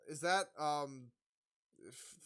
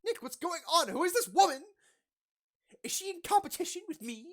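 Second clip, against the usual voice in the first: much higher and louder, jealous alarm; worked-up outburst; 12 dB louder than usual. Acted. [name] jealous of the woman, shrill loud outburst. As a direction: freak out at Nick, high and loud. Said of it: "if I got worked up".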